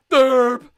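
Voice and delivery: loud, high goofy "derp"; mocking derp. Player mocking own dumb move, loud goofy derp. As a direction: blurt a loud dopey noise.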